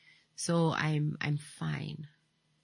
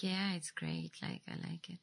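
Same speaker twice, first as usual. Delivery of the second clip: gloomy imitation; 12 dB quieter than usual. Host imitating the caller's gloomy way of talking, mock gloomy. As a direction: imitate a glum caller, trailing down quietly. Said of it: "always sounding gloomy".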